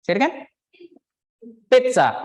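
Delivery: normal talking voice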